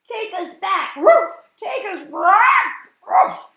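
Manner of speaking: high loud pleading with barks; desperate animal-voiced plea; quacking bird voice. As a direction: plead and bark loudly. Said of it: "Eddie quacked".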